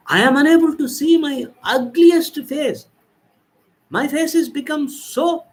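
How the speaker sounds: horrified wail seeing herself in the mirror; horrified scream. Acted. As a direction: shriek at the sight of a ruined face. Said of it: "Like that she is screaming".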